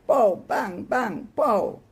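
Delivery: playful gun noises, pow and bang; mock gunshots. Boys in a shooting frenzy, mock gunshot noises. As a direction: boys firing pretend guns, pow bang.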